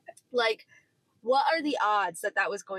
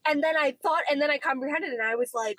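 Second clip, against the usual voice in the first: ordinary delivery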